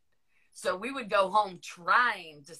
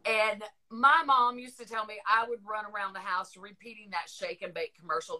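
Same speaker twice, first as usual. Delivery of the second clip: normal talking voice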